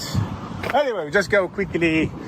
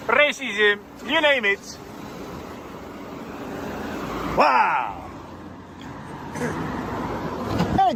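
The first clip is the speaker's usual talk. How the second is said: higher, mocking Scottish accent; put-on Scottish accent; 7 semitones higher than usual. Auditor jeering at officers to a passerby, in a put-on Scottish accent. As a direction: taunt the police in a hammed-up Scottish accent. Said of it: "my Scottish accent was good or not".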